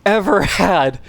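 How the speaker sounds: laughing disbelief; giddy relief. Real, not acted. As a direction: still buzzing from the escape. Relief after close run.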